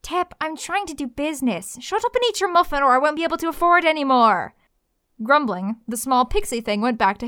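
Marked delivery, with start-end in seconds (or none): 0.0-4.5 scolding. Acted. Maybe scolds the fae.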